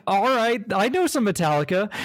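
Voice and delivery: high, playful rock star voice; amused rock star. Retold or imitated. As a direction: play along like a grinning frontman. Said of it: "the Foo Fighter dude's like".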